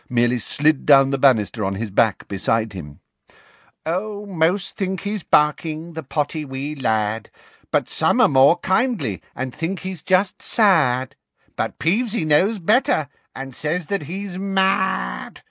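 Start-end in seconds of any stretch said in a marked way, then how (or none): 3.9-15.4 much higher, slow mocking sprite voice; sing-song teasing as the gleeful poltergeist; taunting poltergeist. A narrator's reading.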